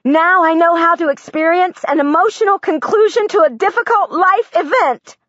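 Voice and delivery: a breakthrough dawning, slowing on the last words; ecstatic realization. Acted. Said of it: "and I think in my head".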